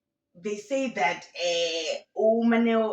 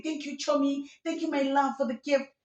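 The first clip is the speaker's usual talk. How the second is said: mock gushing thanks; 6 semitones higher than usual. Mimics friends posting thank-you messages.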